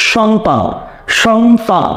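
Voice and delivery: সন্তান firmly as "shontan"; correct sh sound. Shown as a demonstration. Teacher confirming the answer, সন্তান said with sh. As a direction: repeat সন্তান with a clear opening sh. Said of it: "স্বরের উচ্চারণটা স্বরের মতো হয়".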